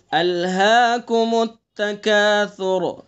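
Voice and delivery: very slow recitation with التکاثر said with a soft sa, not sin; teacher models the soft sa in التکاثر. Shown as a demonstration. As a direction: draw out التکاثر gently, ending in a heavy پر را. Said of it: "سا کو نرمی سے پڑھنا ہے ہمیں".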